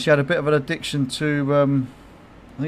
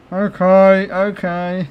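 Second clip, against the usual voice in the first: higher, louder mock surrender; higher, louder mock okay; giving in; 6 semitones higher, 6 dB louder, much slower than usual. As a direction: cave in, exasperated. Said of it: "I'm like".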